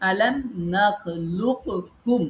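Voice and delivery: "আলাম নাখলুককুম" drawn out very slowly, word by word; slow recitation example. Shown as a demonstration. Teacher slowly reciting a Quran example to the class. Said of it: "এখানে আর একটা উদাহরণ".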